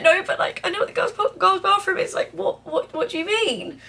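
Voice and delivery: high-pitched retold bewilderment; baffled reenactment. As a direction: replay your confused questioning up high. Retells own baffled reply to girl.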